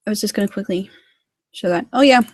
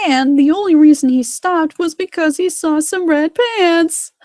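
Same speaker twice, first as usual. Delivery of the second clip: high, loud, drawn-out teasing; mocking storyteller; 8 semitones higher, 5 dB louder than usual. One engine teasing another in the shed, loud mockery. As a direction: jeer it slowly at a rival.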